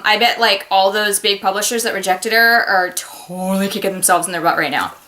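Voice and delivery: ordinary talk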